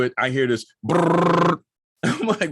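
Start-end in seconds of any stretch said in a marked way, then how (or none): 1.8-2.5 laughing while telling the story. Real, not acted. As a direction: chuckle through the words.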